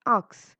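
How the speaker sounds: "ux" said loud and high instead of "ask"; mispronounced ask. Shown as a demonstration. Teacher showing how Malti says "ux". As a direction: bark out "ux" for "ask". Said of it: "She couldn't say the word".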